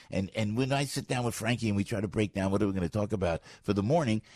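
normal talking voice

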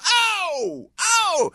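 high-pitched drawn-out crowd "oh"; crowd roar imitation. Radio host mimicking the concert crowd, high whooping cheer. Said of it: "hear the crowd go".

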